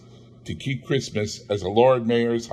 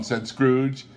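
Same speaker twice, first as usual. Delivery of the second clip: usual speaking voice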